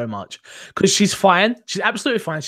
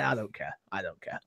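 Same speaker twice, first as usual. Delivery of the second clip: much lower, much softer uncaring mumble; bored indifference; 10 semitones lower, 16 dB quieter than usual. Retold or imitated. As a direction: shrug it off in a low murmur. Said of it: "She's like".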